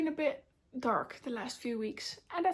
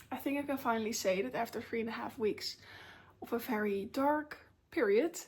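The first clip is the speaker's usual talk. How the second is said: usual speaking voice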